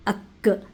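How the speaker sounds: अक with a light front k, loud; wrong front k. Shown as a demonstration. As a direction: show the wrong अक from the mouth front. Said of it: "ये बिल्कुल भी नहीं आएगा". (अक गलत, सामने वाली क)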